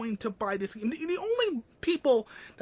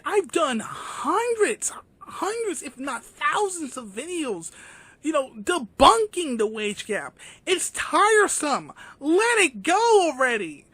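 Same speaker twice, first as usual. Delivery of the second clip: exasperated; 5 semitones higher, 6 dB louder than usual. Real, not acted. Exasperated rant about debunking videos.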